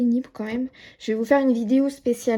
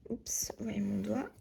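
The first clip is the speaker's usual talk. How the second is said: whisper; 11 dB quieter than usual. Real, not acted. Finger caught on camera.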